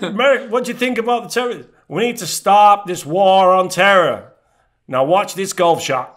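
mock presidential address; mock Bush. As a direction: ham up a pompous leader's address. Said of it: "He said, he said".

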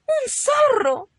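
very high, loud, drawn-out cry; terrified mother shrieking; frightened scream. Acted. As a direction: cry out in sheer alarm. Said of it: "gritó mamá, muy asustada".

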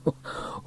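short, soft chuckle; laugh. A narrator's reading. Sam hearing the twins' news, chuckling. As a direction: begin to laugh softly. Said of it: "He began to laugh".

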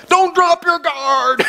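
coach yell. Retold or imitated. Boxing coach yelling at the young fighter from the sideline. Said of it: "the coach yelled from the sideline".

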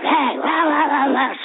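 high, garbled mock rant; mock screaming. Angry lady at the game screaming at two fans, mock screaming. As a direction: imitate a woman screeching nonsense at you. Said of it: "this lady is just screaming at us".

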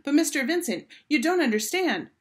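high, urgent call; calling after. A narrator's reading. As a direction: call after someone walking off. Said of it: "She called".

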